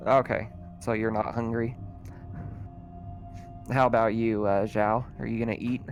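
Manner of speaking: usual speaking voice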